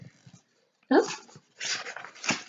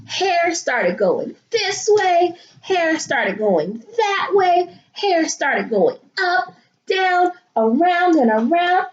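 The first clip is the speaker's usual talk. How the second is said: higher sing-song reading; higher, slow, playful; 7 semitones higher than usual. A narrator's reading. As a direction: lilt it up slowly. Hair springing loose.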